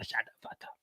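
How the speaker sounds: mock whisper. Mocking Tasha's hidden thoughts.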